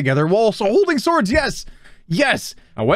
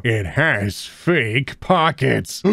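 grave voice; 5 semitones lower, much slower than usual. Acted. Voicing a meme character.

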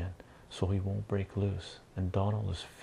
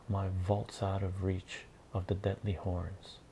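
plain reading voice

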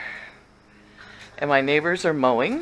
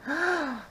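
excited gasp; 9 semitones higher, 6 dB quieter than usual. Real, not acted. Excited by French toast idea.

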